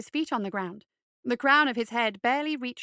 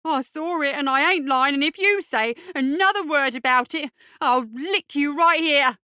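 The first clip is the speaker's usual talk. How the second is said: put-on louder, slowing threat from a boy; threatening; 4 semitones higher, 7 dB louder than usual. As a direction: warn him, pushing each word. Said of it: "Boyle threatened".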